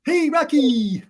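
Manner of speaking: cartoon moose hey; Bullwinkle voice. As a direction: call out like Bullwinkle. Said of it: "not like".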